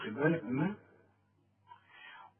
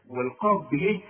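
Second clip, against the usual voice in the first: ordinary talk, nothing marked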